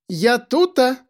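high bright call through the house. A narrator's reading. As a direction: call out cheerfully. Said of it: "крикнула она".

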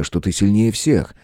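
ordinary delivery, nothing marked

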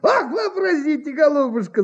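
high, gleeful exclamation with laughter; tickled, giddy announcement; beaming delight. A narrator's reading. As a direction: burst out happily as the relieved impresario. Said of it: "сиял и потирал ... от удовольствия".

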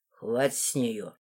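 low, soft, slow man's voice; protective Руфус. A narrator's reading. Руфус shielding the heroine from the princess, low and firm. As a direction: end it quietly in a low voice.

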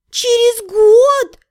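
high, slow, stretched-out complaint; drawn-out whine. A narrator's reading. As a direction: drag the words out in dismay. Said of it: "протянула сестра".

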